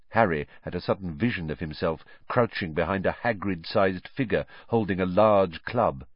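plain reading voice

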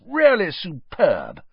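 slow, high and loud praise; Bagman's hearty voice. A narrator's reading. Bagman praising Harry, slow and hearty. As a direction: boom it out like a jolly official.

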